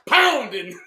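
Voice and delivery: usual speaking voice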